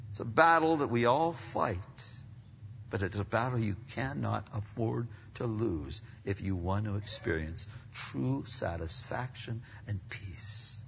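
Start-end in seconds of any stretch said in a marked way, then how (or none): none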